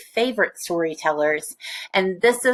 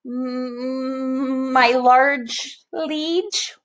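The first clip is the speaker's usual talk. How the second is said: higher, slow, stammering whimper; whimpering steward; 5 semitones higher, much slower than usual. A narrator's reading. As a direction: cower before the king. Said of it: "whimpered the steward".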